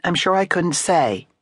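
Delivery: curt dismissive reply; mildly curt flapper; short. A narrator's reading. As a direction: brush him off curtly. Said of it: "said Ardita shortly".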